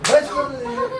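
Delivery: can't believe what you're seeing; amazed disbelief. Real, not acted. Mother repeats the question, amazed.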